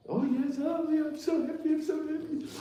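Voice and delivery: high, fast swooning mock bliss; mock blissful lover. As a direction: ham up a lovesick person gushing.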